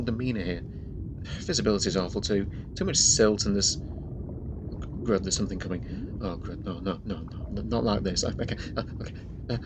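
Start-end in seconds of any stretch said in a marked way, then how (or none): 3.8-9.6 softer, panicky hushed muttering; frightened hush. Acted. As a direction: back away in mounting fear. Something approaching in the murky water.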